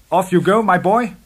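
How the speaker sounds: high, loud, encouraging call; hearty send-off. A narrator's reading. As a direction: send the nephew off briskly. Uncle sends nephew off.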